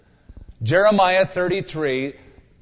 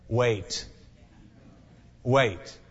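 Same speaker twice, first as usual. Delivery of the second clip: solemn quiet "Wait"; 5 semitones lower, 5 dB quieter than usual. Acted. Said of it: "this is the dreaded answer".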